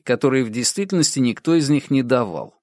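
plain reading voice

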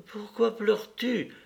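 put-on high child's voice with a touch of tears; a little boy about to cry, lightly played; near tears. A narrator's reading. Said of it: "prêt à pleurer lui-même". (voix d'enfant aiguë, un peu au bord des larmes)

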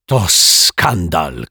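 slow, drawn-out exclamation of outrage; stretched, emphatic protest; outraged cry. A narrator's reading. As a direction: cry it out in scandalised indignation. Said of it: "krzyknęła pani Ryczaj".

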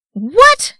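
very high, loud outraged shout. A narrator's reading. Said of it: "shouted Legolas".

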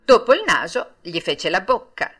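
usual speaking voice

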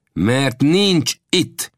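slow, decisive statement; firm denial. A narrator's reading. Old Death firmly denies the Apache is there. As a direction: state it flatly, no doubt allowed. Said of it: "jelentette ki Old Death határozottan".

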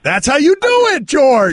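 high-pitched, hollered praise; excited congratulatory yell; rowdy approval. Real, not acted. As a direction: cheer him on like a proud coach. Cheers guest's blunt answer.

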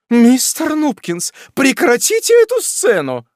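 high loud irritated command; irritated wife. A narrator's reading. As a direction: snap at her husband to end it. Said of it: "раздражённая супруга".

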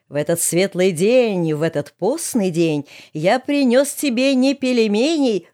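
slow, drawn-out sing-song verse; mock folk bard. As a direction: declaim it like a ballad singer. Герой декламирует шуточные стихи. (нараспев, как былинный сказитель)

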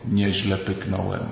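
low, soft, slow and offhand; deadpan understatement. Retold or imitated. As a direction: shrug it off coolly. Understated male reaction, contrasted.

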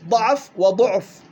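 ضعف read first with an a, then وضعف with a u, slowly; slow two-way demo. Shown as a demonstration. Teacher showing Hafs's two readings to students, slow and clear. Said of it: "قرأ حفص بوجهين".

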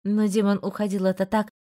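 ordinary delivery, nothing marked

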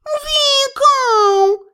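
high, drawn-out cat cry; yowling cat. A narrator's reading. Cat flees to the mistress, wailing. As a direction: stretch the name into a high yowl.

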